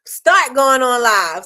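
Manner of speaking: slow, loud, stress on Start; emphatic correction. Real, not acted. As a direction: punch Start going on live.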